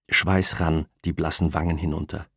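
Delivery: usual speaking voice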